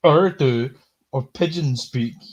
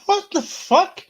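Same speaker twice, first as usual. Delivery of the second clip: a high, slow, stunned what the fuck; disbelief; 3 dB louder, slower than usual. Real, not acted. Host stunned by the guest's phobia quote, drawn-out disbelief. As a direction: drag it out in utter disbelief. Reacting to the absurd article quote.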